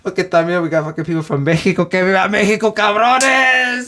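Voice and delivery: put-on high, loud Mexican-accented hype.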